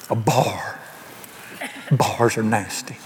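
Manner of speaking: low, soft, slow mock disdain; hushed disapproval. Religious man spotting a bar, hushed mock disapproval. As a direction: drop low and soft, acting pious.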